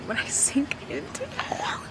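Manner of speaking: high, breathy, soft whisper; swooning whisper. Real, not acted. Reader reads a swoony hero line, whispering. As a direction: melt into the line as you whisper it.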